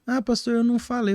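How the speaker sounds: doubting churchgoer. Retold or imitated. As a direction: make a small high protest, quoting someone. Acts out a doubting church member. (fiel em dúvida, voz fininha imitada)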